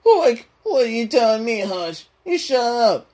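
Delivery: high, indignant cartoon slacker; Shaggy. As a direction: talk back in a strained sidekick voice.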